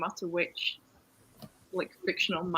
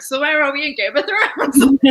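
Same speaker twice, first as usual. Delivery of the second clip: louder, slow, laughing question; mock puzzled self; 8 semitones higher, 13 dB louder than usual. Friend mocking map-obsessed readers, loud slow laughing question. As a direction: play it as a baffled reader, laughing and drawn out. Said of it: "and be like".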